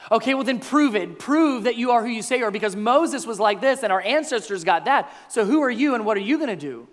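insistent, challenging push; demanding challenge. Acted. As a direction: fire demands at him. Crowd demands proof from Jesus.

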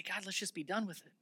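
hushed, defeated plea; quiet surrender to God; weary prayer. Acted. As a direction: mutter it softly, ready to give up.

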